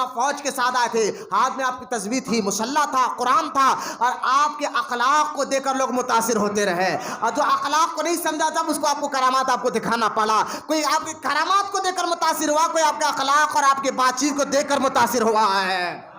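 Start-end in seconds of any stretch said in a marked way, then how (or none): none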